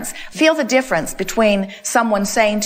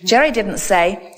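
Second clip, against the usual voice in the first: ordinary talk, nothing marked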